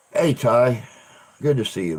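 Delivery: ordinary talk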